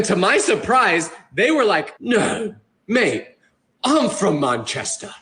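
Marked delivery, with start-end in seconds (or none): 2.0-5.1 imitated British local, slow; put-on British accent at full voice, drawn out. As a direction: play a real Brit from Manchester. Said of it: "that exact same accent".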